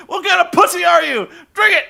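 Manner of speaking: high, loud barking yell; hammed-up shout; mock shouting bully. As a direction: yell like a bully demanding he drink. Retelling a character yelling at Rick.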